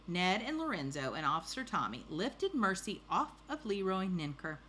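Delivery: ordinary delivery, nothing marked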